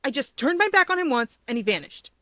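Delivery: high, loud panic; panicked friend on the phone. A narrator's reading. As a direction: voice jumping up in alarm. Kudo panics over lost cousin.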